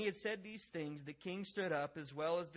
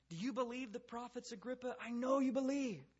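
presumptive close; 7 semitones higher than usual. Acted. Paul closing in on Agrippa, high and presumptive. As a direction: press him like a pushy closer. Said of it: "what we call the presumptive close".

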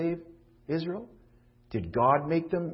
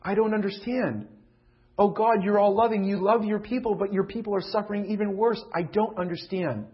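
raised, impassioned preaching; preaching at full force; 5 semitones higher, 4 dB louder than usual. Acted. Pastor preaching loudly to his church, impassioned. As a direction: plead the question aloud to the congregation. Preacher voicing Moses' complaint.

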